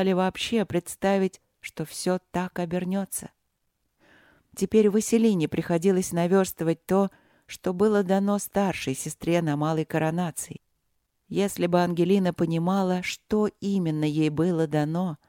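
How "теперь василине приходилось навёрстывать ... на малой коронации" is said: plain reading voice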